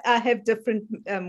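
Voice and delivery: ordinary talk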